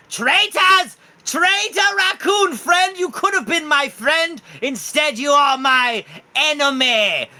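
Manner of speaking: very high, loud, slow squealing accusation; Lord Jimothy accusing. Acted. Raccoon king biting August, shrieking betrayal. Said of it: "as Jimothy goes like".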